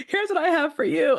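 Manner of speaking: high, loud teasing setup; playful role play. Pretend. Mom playing Gio in role play, high and loud. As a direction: build up the joke like a showman. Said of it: "I pretend to get on my bag".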